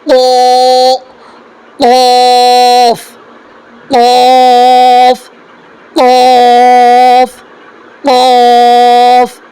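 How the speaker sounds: قاف pushed firmly from the uvula and drawn out slowly; firm, slow model of قاف; firm qaf demo. Shown as a demonstration. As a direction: hit each قاف hard and hold it. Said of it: "تھوڑا سختی سے وہاں پر سے آواز نکال".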